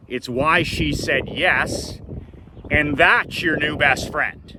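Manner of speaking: imitating a dad setting his son straight; emphatic fatherly lecture. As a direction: drive each point home to your son.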